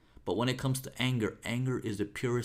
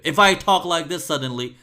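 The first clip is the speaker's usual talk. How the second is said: higher, much louder sudden yell; sudden shout; 7 semitones higher, 12 dB louder than usual. Shown as a demonstration. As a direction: burst out to startle them. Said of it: "me suddenly increasing my tone".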